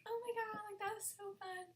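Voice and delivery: high, light imitation of excited girls; put-on high-pitched fangirl voice; mock fangirls. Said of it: "five girls, they were all like".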